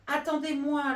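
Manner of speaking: high, loud call to wait; tortoise calling. Acted. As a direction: call out, left behind. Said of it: "lançait la tortue".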